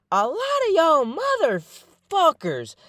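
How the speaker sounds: high, slow, stretched-out mock call-out; drawn-out emphasis. Shown as a demonstration. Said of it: "and I'm saying it like that".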